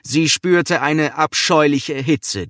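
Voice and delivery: ordinary delivery, nothing marked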